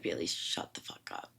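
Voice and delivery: mom's put-down hissed in a whisper; whispered brush-off. Retold or imitated. Mom whispering back to her panicking daughter. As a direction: drop to a whisper for mom's reply.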